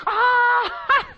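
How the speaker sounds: high loud burst of laughter; loud laughing shriek. Shown as a demonstration. Preacher showing how Sarah did not laugh, a loud cackle. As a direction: blurt a big cackle. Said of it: "It wasn't like that".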